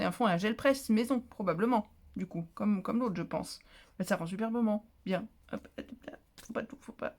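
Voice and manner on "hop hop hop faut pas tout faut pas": chuchoté, très doux; murmure. Real, not acted. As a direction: se parler tout bas en manipulant. Manipule l'enveloppe, murmure. (murmurer pour soi)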